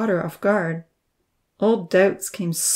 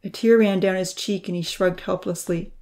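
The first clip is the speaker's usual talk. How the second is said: usual speaking voice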